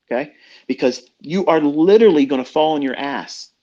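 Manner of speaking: usual speaking voice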